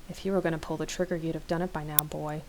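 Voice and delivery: low, soft menacing taunt; sinister goading. A narrator's reading. Rick goading Riley with gun at his head, sinister. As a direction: keep it quiet and low, daring him. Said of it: "Rick giggles and smiles".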